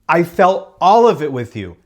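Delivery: the same line pushed up and out; emphatic. Shown as a demonstration. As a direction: say it again and really mean it.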